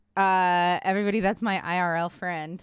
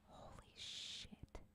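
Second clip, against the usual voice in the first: breathless shocked whisper; 27 dB quieter, much slower than usual. Real, not acted. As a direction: hush it, stunned. Shocked at a discovery.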